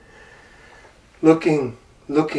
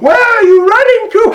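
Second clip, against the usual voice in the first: very high, loud monkey chatter; cheeky monkey; 9 dB louder than usual. Acted. Monkey calling to the running tiger, high and cheeky. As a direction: tease the tiger playfully.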